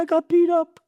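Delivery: put-on high, whimpering little-boy voice; crying child. Retelling being a beaten-up boy, high child's voice. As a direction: pipe it up like a hurt small kid. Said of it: "He said".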